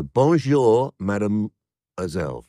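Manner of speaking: slow, loud French greeting; a hint of a French accent, drawn out; lightly hammed French greeting. Shown as a demonstration. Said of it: "if I do it in the French accent".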